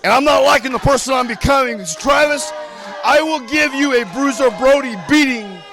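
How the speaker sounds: loud, furious wrestling promo yell; angry shout. Acted. Wrestler threatening Travis Cook on camera, furious shout. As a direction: bellow your hatred at your rival.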